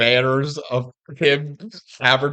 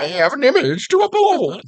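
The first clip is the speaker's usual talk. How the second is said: put-on much higher, far-off snooty mean girl voice; mock mean girl; 9 semitones higher than usual. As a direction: ham up the stuck-up girlfriend. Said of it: "echo of the mean girl's line".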